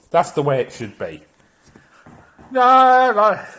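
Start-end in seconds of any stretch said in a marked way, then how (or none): 2.5-3.5 sudden loud outburst. Real, not acted.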